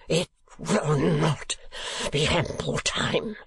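put-on a drunk man hissing his outrage, then crying out; outraged demand. A narrator's reading. Sir Mulberry to the crowd after the blow, strained fury.

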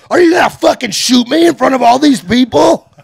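incredulous shout. Retold or imitated. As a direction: yell it at the guard in disbelief. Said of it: "confronting security guard with a gun".

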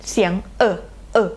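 short neutral "เออ" schwa, held lightly; schwa sound. Shown as a demonstration. Teacher demonstrating the schwa sound "เออ". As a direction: model the schwa "เออ" for students. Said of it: "มันจะมีลักษณะเหมือนตัว E กลับหัว".

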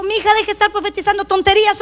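mock scolding sister. Church sister scolds a woman for false prophecies, fast and nagging. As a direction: rattle off the nagging rebuke.

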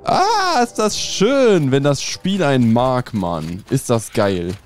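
high, loud, drawn-out delight; elated. Real, not acted. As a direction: crow with joy at a lucky break. Elated at a lucky game drop.

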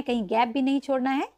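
ordinary delivery

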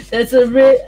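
normal talking voice

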